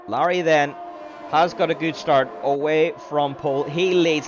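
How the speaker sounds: loud race call; lively play-by-play of the lead; motorsport commentator. Acted. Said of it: "I will do my best to commentate".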